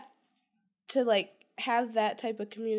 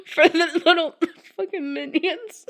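laughing; 4 semitones higher, 11 dB louder than usual. Real, not acted. Laughing while describing The Wiz.

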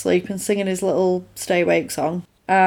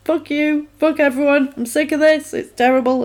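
high, ranting fury; mock rage; 6 semitones higher than usual. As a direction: spit it out in a mild mock rage. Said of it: "He's got rage".

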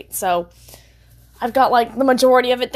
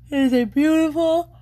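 words stretched by a yawn; 5 semitones higher than usual. Real, not acted. Tired vlogger describing the day, yawning through it. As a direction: start the line mid-yawn. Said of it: "oh, I'm yawning".

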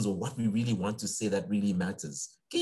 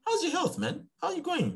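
earnest son asking. Retold or imitated. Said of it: "But then one day I said".

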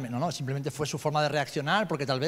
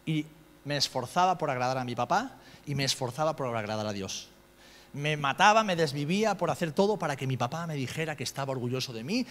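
ordinary delivery

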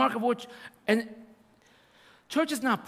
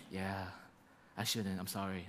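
sheepish apology; 13 semitones lower, 13 dB quieter than usual. Retold or imitated. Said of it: "He's like".

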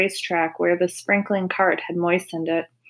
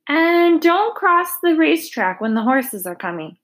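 raised, higher anxious warning; mild cry; 8 semitones higher, 3 dB louder than usual. A narrator's reading. Mrs Zuckerman calls a warning to the children. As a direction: call it after the kids, a touch worried. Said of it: "cried Missus Zuckerman".

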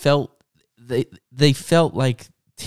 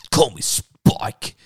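slow, lightly voiced cool bad boy; much slower than usual. Spike introducing himself to Kenny, slow cool bad boy. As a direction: drawl it like a kid playing it cool. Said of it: "And he's like".

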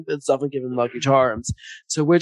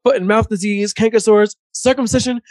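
mock Bernie Mac; 7 semitones higher, 4 dB louder than usual. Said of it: "he's like".

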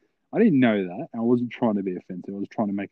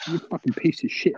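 higher-pitched mock insult; imitating angry girl; 8 semitones higher than usual. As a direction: hurl it as the outraged girl. Girl insulting him, retold.

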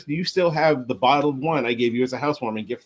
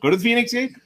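echoed slogan; 8 semitones higher, faster than usual. Acted.

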